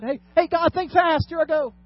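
high, loud playful shout; mock heads-up call. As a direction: call up to God like a prankster. Acts out daring God to catch.

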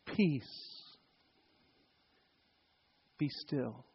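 very soft, low, gentle hush; nice, comforting. Shown as a demonstration. Jesus to the sea as people imagine it, comforting. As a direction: soothe them softly with calm words. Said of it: "you want it to be that nice, comforting".

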